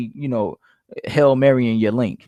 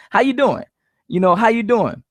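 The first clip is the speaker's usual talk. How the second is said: upswing opener, lifted high; high-pitched "how you doing" demo; 7 semitones higher than usual. Shown as a demonstration. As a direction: open the call with a high, questioning lift. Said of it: "have an up-pitched voice".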